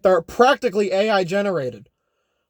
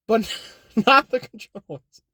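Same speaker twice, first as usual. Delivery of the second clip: laughing. Real, not acted. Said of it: "resist making that joke".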